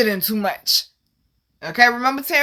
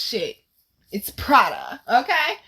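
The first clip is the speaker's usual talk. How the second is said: ordinary talk, nothing marked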